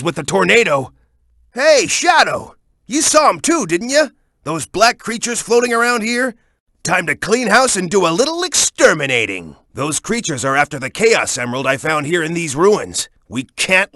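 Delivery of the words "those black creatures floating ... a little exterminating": usual speaking voice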